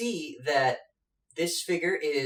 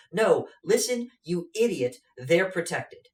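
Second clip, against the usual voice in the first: higher, louder scolding snap; exasperated scolding; 4 semitones higher, 5 dB louder than usual. Acted. Debilica scolding the giant, higher exasperated snap. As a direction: chew him out for being dense. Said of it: "Debilica says".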